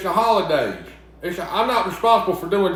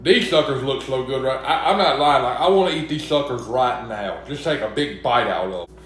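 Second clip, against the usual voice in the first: ordinary talk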